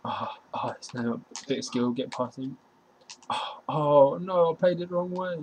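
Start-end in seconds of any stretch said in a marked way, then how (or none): 3.3-5.4 frustrated cry. Real, not acted. Groans at own mistake.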